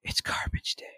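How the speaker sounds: put-on hushed whisper, as a just-woken kid; whispered child imitation. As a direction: whisper it like a child's first waking words. Voicing son just woken up.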